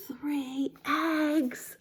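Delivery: awed whisper after a gasp; hushed amazement. Acted. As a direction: breathe the count in wonder. Counting eggs with a gasp.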